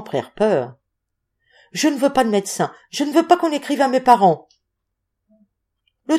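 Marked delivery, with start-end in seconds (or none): 1.7-4.5 higher-pitched, insistent protest; feverish refusal. A narrator's reading. Sick boy to the landlady about doctor and parents, insistent refusal.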